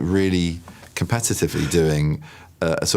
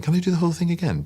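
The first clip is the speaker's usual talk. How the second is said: eager request; 12 semitones higher, faster than usual. Retold or imitated. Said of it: "And then saying".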